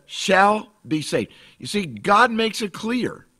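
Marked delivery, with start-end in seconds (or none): 0.1-1.3 slow, heavy "Shall" at the start; drawn-out, emphatic "Shall be saved"; stress on shall. Shown as a demonstration. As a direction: land on "Shall" like a guarantee. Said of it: "Bible says".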